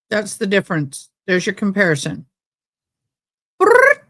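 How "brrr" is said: high rising lip burst; rapid-fire gun noise. Retold or imitated. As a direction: spit it out like a machine gun. Imitating rapid gunfire.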